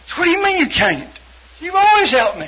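high, loud mock protest; indignant brother. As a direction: act out a brother's outraged pleading. Retold angry brother on phone.

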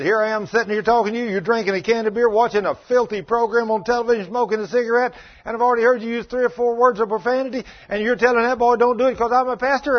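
loud, high preaching rebuke; heated confrontation. Retold or imitated. Preacher loudly rebukes smoking, drinking father. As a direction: drive each charge home at him.

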